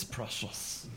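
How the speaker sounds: hissed, whispered Gollum rasp; breathy creature whisper. Shown as a demonstration. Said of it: "then I turn around and talk like this".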